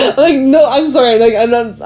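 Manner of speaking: helpless laugh breaking into speech. Real, not acted. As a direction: try to get words out while giggling. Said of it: "I'm sorry for laughing".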